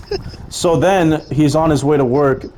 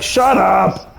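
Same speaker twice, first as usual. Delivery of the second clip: high, sharp shouted retort; bum yelling back; 7 semitones higher than usual. Retold or imitated. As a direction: bark it back at the honking driver. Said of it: "the bum's like".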